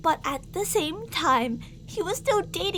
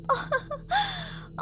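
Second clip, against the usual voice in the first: creepy giggle; 12 semitones higher, 4 dB quieter than usual. Acted. Captor laughs at the darling waking.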